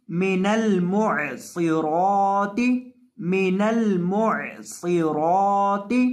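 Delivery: मिनल मुअसिराती chanted slowly, मिनल joined as one word; slow Quranic recitation. Shown as a demonstration. (धीमी तिलावत, मिनल जोड़कर)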